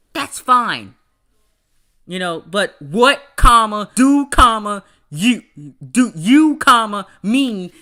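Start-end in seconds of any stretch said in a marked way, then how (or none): 2.8-7.7 each word of "what, do, you, mean" punched loud, with "comma" read aloud; mock over-punctuation, loud and choppy; comma-chopped question. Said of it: "that bothers me".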